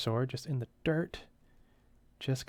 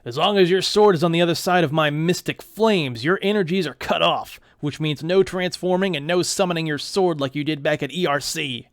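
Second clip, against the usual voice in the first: loud gloating villain over his flames; villain taunt; 2 semitones higher, 10 dB louder than usual. Acted. Villain taunting Neil across flames, loud and gloating. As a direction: taunt the hero from behind your fire.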